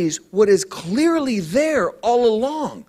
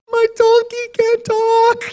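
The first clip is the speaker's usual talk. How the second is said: high, far-off squeak of disbelief; freaked out; 14 semitones higher, 3 dB louder than usual. Pretend. Preacher imagines his donkey speaking, high shocked squeak. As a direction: yelp in shock. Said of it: "I might say something like".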